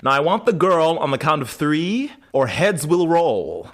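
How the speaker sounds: slow, lofty mock threat; menacing Elijah. Elijah threatening the brothers, slow mock menace. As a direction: draw out the ultimatum like a gentleman villain. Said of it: "And he's like".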